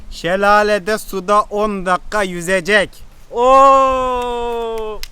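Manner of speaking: loud, slow triumphant reading; gloating announcement. Real, not acted. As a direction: announce it like a victory. (zafer edasıyla yüksek sesle)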